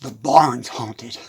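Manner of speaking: very low, slow ominous quote; Buttermilk. Retold or imitated. Cow announcing the barn is haunted, low and slow. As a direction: drop deep and draw it out. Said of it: "Buttermilk says".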